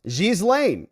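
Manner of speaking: Ghislaine with a soft zh, zheez-layn. Shown as a demonstration. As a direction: try Ghislaine with a soft zh start. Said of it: "I was a little bit harder on the J".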